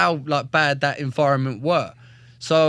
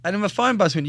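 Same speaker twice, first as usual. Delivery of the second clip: ordinary talk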